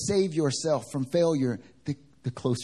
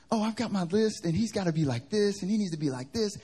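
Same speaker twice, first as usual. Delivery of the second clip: higher-pitched imitation of a woman listing demands; mock picky dater; 8 semitones higher than usual. Sister in the van describing her dating list, higher mocking voice. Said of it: "one of them was talking about".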